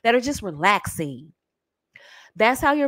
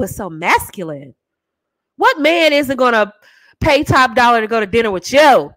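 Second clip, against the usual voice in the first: ordinary delivery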